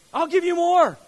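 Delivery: high, very loud shout as God; booming proclamation. Acted. God answering the faithful giver, booming proclamation. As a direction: shout God's generous answer out to the room. Booms out God's answer to congregation.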